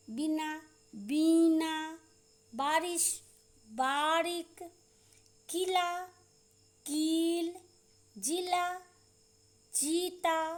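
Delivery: "पिता, पीता" word by word, short i then drawn-out ee; short-long vowel drill. Shown as a demonstration. Teacher drilling short and long ई for pupils, careful dictation. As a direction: hold the long ई in "बीना", keep "बिना" short. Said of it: "छोटी ई और बड़ी ई की मात्रा वाले सब बोलेंगे".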